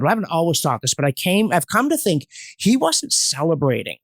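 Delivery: ordinary talk, nothing marked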